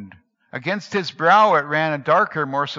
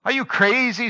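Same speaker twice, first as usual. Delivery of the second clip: high scornful sneer; one-armed man mocking the ragman; 9 semitones higher, 3 dB louder than usual. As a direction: throw the question back with contempt. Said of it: "sneered the other".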